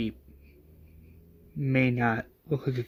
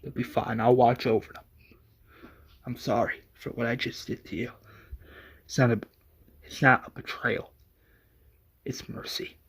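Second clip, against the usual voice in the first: soft, subdued, halting confession; hushed, understated grief with long pauses; tearful apology; 2 semitones lower, 4 dB quieter than usual. A narrator's reading. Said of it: "in tears".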